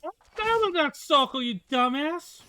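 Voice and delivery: high, loud gamer outburst; gamer rage. Acted. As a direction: snap at the screen in fake rage. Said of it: "I have my gamer rage moment".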